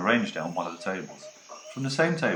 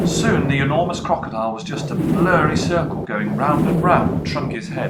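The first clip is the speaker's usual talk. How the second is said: louder, higher, ever more thrilling narration; building excitement; 7 semitones higher, 10 dB louder than usual. A narrator's reading. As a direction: read the rocket flight with rising thrill. Building story climax.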